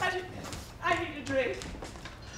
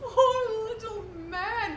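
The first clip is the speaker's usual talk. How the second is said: slow, higher, half laughing half crying; laughing pity; 7 semitones higher, slower than usual. Acted. Tipsy actress remembering a sweaty suitor, slow and laughing. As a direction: drag it out through giggles.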